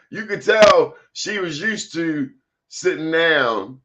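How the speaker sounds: ordinary delivery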